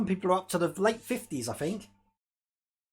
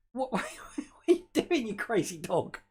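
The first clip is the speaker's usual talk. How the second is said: amused exasperation; 3 semitones higher than usual. Real, not acted. Owner to his crazy dog, laughing exasperation. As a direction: laugh helplessly while questioning the dog.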